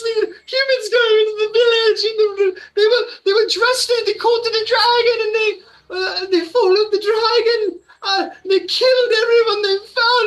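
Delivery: high, loud sobbing elf; distraught elf woman. Acted. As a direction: lose it while telling the massacre. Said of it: "she's just losing it".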